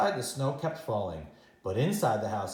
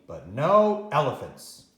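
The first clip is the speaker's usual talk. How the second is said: slow, louder, stressing NO; firm slow refusal; 6 dB louder, slower than usual. Acted. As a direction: lay down the rule, slowly. Grandma's firm condition.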